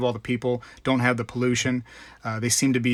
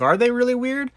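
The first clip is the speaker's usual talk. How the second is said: mock disbelief; 10 semitones higher than usual. To his friend, doubting that bidets are weird, mock disbelief. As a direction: pitch jumps way up on the question. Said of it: "And I'm like".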